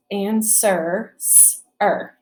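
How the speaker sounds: "sir" said whole, then split into "s, er"; teacher isolating each sound in "sir". Shown as a demonstration. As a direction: segment "sir" into two separate sounds. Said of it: "Two sounds".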